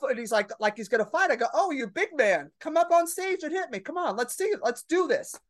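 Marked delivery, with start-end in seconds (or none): 1.5-5.4 mocking taunt. Taunting the heckler to fight.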